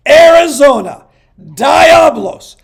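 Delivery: building hype, slow and loud, into a shout on the names; hype countdown. Real, not acted. Host hyping his fantasy league rankings, building to shouts. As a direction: announce the rankings like a hyped host. Announcing the surprise team.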